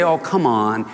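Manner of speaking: low, easygoing mock objection; mild protest. Jesus not just mildly objecting to disciples, mild protest. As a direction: toss it off as a mild complaint. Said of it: "He didn't just say".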